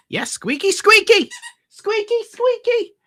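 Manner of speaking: put-on high squeaky baby-talk to a pet; playful squeaking. Squeaks along with squeaky toy.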